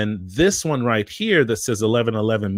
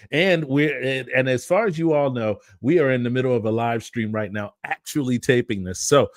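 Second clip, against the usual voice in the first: ordinary talk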